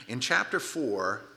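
usual speaking voice

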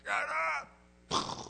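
sharp loud Shut up!, the up shouted; yelling at a dog. Shown as a demonstration. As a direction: bark it at the barking dog. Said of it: "the thing that you yell at the dog".

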